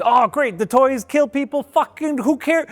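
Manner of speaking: high, sarcastic scoff at the plot; hammed-up sarcastic high voice; mock dismissive viewer. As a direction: sneer at the story like a bored viewer.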